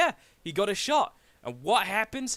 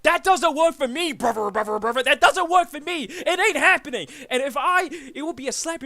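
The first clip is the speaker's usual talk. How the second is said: louder, heated promo yell; 6 semitones higher, 7 dB louder than usual. Real, not acted. Wrestler shouting at rival in promo, loud and heated.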